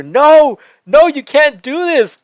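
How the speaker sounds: high, loud cry of protest; reliving the yell at a shocking on-screen stab; yelled in the theater. Retold or imitated. Fan reliving his theater outburst, loud high yell of protest. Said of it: "I yelled in the theater".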